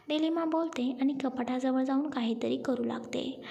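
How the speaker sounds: usual speaking voice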